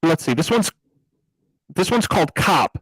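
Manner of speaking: overdriven, distorted mic sound; cop voice filter. Acted. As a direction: talk through a gain-blown cop filter. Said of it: "sound like someone who has their gain turned up too much".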